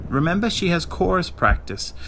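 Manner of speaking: high, light motherly reminder. A narrator's reading. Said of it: "her mother said".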